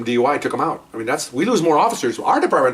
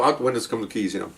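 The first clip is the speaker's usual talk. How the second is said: ordinary delivery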